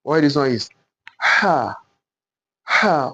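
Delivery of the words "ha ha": "ha" as a breath pushed out from the throat; breathy ha. Shown as a demonstration. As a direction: breathe out through "ha". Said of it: "We are going to breathe out".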